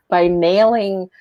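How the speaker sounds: ordinary talk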